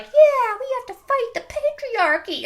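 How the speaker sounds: high-pitched mock enthusiasm; mock rallying cry. Mocks others' rallying cry.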